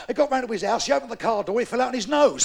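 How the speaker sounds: ordinary delivery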